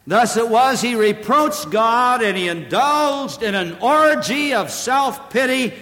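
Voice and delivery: high, loud pulpit voice; full-force preaching. Real, not acted. Preacher urging the congregation toward repentance, full force. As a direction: preach it out, driving hard.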